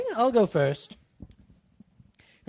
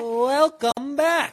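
drawn-out, high, loud playful greeting; playful welcome; 10 semitones higher, 10 dB louder, much slower than usual. Acted. Podcast host welcoming listeners back, playful and big. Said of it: "yours was more playful".

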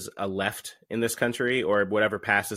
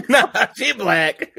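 higher, louder, slow and laughing; laughing echo; 13 semitones higher, 10 dB louder, much slower than usual. Acted. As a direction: echo the joke through big laughter. Repeats friend's joke laughing.

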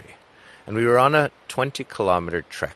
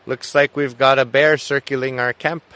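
loud, higher call across the camp; 8 semitones higher, 9 dB louder than usual. Retold or imitated. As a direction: shout over to the others at camp. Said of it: "call over and say".

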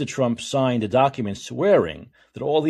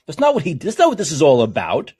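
usual speaking voice

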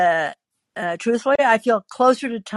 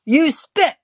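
higher, louder "you did"; accusing retort; 8 semitones higher, 3 dB louder than usual. Acted. Woman bickering with her husband, accusing. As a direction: throw it back accusingly.

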